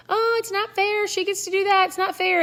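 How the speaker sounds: put-on high, far whiny child voice whining; whiny kid. Kid whining to parent about unfairness, high whiny voice. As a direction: whine like a kid who got left out. Kids whining it's not fair.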